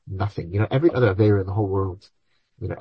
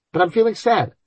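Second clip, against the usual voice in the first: whiny protest; 10 semitones higher than usual. Retold or imitated. Imagined person protesting to rabbi.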